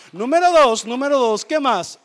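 loud, punchy preacher call; preaching shout. Real, not acted. Preacher rousing the congregation, loud announcing. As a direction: call out the next point to the church.

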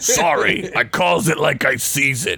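lightly voiced Klingon parody; a hint of a folksy, unapologetic warrior; Worf. Worf excusing himself for crude talk, lightly put-on voice.

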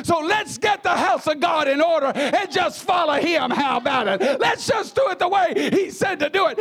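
rising, shouted preaching to the congregation; loud, high fervent exhortation; preaching at full force. Real, not acted. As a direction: build it up and shout it out like a revival preacher.

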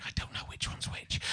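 hushed aside; whispering. Real, not acted. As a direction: whisper it like a secret. Confiding aside about the magicians.

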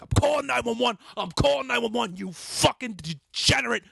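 high, loud yell threatening the police; retold enraged screaming. Threatening to call nine one one, retold enraged screaming. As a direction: re-enact screaming threats, peaking on the final question. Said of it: "I'm screaming".

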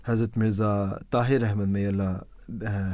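ordinary talk

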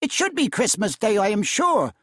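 wife railing against her husband's stingy boss; indignant wife. A narrator's reading. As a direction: spit out the insults. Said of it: "said she".

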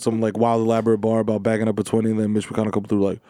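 ordinary talk

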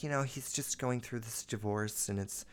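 very soft, discreet explaining voice; hushed apologetic mom. Retold or imitated. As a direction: imitate mom quietly making excuses. Mom excusing son to teacher.

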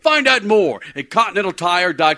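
upbeat, punchy announcer ad read; radio ad read. Acted. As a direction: sell it like a radio spot. Live sponsor ad read.

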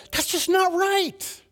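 very high, slow cry of injustice; indignant protest. Acted. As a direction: voice the conscience objecting, pitched right up and drawn out. Inner protest at racism, acted.

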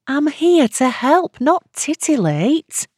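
slow, high-pitched, tightly held irritation; slow indignant retort, teeth clenched; through gritted teeth. A narrator's reading. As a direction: push each word out through gritted teeth. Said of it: "said through gritted teeth".

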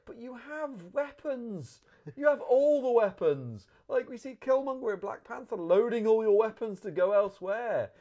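loud, high, incredulous outburst; exasperated rant. Real, not acted. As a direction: protest the plot hole at full volume. Exasperated rant at film's plot.

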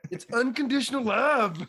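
high, loud eager answer; excited answer. Real, not acted. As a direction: announce it like the winning answer. Triumphant answer to the quiz.

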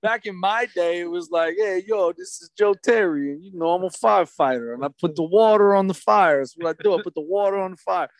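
a simple guy proudly introducing himself; old-school firefighter impression. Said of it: "He's like".

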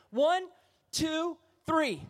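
preacher counting the crowd in loud and high; calling out the count. Real, not acted. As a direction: call the count out to rally them.

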